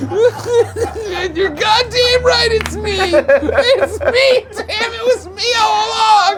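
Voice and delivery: high, tearful laughter; cry-laughing through the punchline; laughing hysterically. Real, not acted. Laughing at own reveal.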